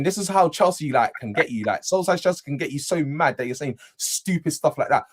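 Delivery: ordinary delivery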